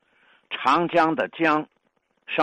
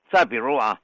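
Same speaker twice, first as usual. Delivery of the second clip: usual speaking voice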